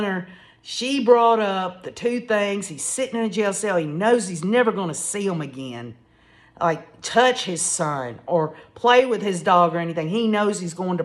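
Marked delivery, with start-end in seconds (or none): none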